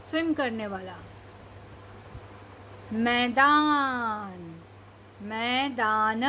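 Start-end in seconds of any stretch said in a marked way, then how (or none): 2.9-6.2 मैदान drawn out slowly, falling; slow reading. Shown as a demonstration. Teacher reading the word slowly for children. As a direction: stretch मैदान out long twice. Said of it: "धीरे धीरे पढ़ने की कोशिश करिए".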